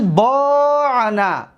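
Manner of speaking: با نه said very slowly, syllables separated; spelling out با نه slowly; slow demo. Shown as a demonstration. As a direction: lay out با نه slowly for the class. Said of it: "دقت دادید؟".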